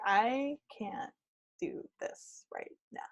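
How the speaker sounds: soft retold admission of defeat; hushed, defeated self-talk; quiet giving up. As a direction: let it sink away almost to nothing. Retells giving up on the directions.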